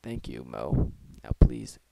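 low whisper right in your ear; close-mic whisper. Acted. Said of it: "I was standing up close to the mic and whispering".

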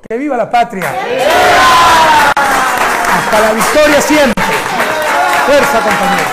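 shouted rally cries, drawn out; ranting dictator speech. Real, not acted. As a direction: roar it to the crowd. Rally speech, cheering the crowd. (discurso de mitin a gritos)

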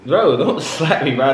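laughing while pleading; half-laughing, don't slap me; laughing protest. Acted. Gets slapped, laughing protest.

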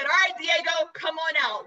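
mildly projected show call. Retold or imitated. Calling the hawk out in show.

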